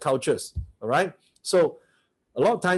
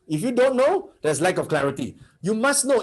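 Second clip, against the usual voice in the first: insistent push; 7 semitones higher than usual. Real, not acted. Coach pressing the audience.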